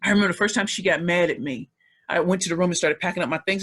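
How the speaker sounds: ordinary delivery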